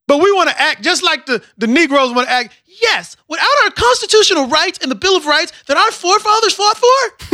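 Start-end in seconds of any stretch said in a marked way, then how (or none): 2.8-7.4 higher, lightly put-on respectable tone; mock proper voice. Mocking respectable Negroes reciting rights, mild mock voice. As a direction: parody the polite citizen quoting his rights.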